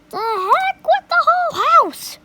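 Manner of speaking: high, loud, fast shout; grandma shouting. A narrator's reading. Grandma shouting defiantly at George. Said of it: "she shouted".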